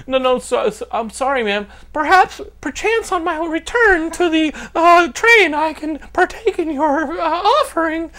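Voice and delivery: high, slow, flustered over-politeness; Southern manners. Acted. Evading a hooker on the street, mock-genteel apology. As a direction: decline with hammed-up genteel courtesy. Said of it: "I used my southern, like, manners".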